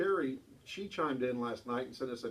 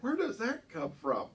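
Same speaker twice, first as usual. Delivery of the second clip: high, laughing what what; half laughing, half baffled; laughing disbelief; 9 semitones higher, 3 dB louder than usual. Real, not acted.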